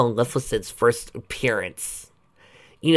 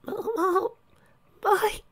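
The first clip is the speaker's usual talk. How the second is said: very high, faint little voice; fading whimper before fainting; weak whimper; much slower than usual. Acted. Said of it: "Oculus whimpered before passing out".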